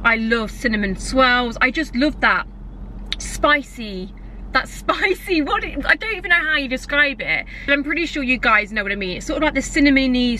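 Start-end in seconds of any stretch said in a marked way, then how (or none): none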